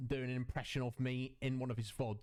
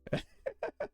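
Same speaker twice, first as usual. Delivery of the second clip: high giggle. Real, not acted. Laughing after his impression.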